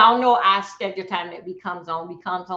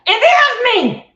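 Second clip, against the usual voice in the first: high, loud protest; insistent shout; 12 semitones higher, 10 dB louder, slower than usual. Real, not acted. Streamer insisting the girl in the picture is herself, insistent shout.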